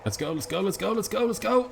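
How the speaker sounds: high, rising panicked cries; panicked urging. Real, not acted. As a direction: panic climbing as they chase. Chased by dogs in game.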